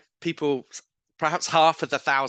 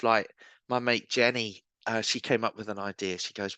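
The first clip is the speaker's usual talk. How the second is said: ordinary talk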